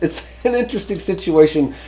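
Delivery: amused laugh breaking into the line. Real, not acted. Reviewer chuckling about copycat antennas, laughing.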